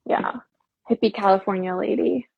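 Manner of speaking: ordinary talk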